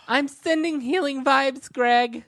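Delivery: high, sing-song mock of a vibes person; mocking airy voice. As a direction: sneer it up high like a wellness type. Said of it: "How is that different than a prayer".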